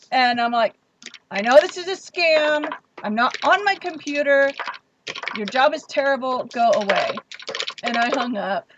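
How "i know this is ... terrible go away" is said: loud, irritated telling-off; retold fed-up outburst. Woman telling off a scam caller, retold irritated outburst. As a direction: retell it as an exasperated, raised-voice dismissal. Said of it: "I was not having anything at all".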